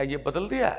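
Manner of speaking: usual speaking voice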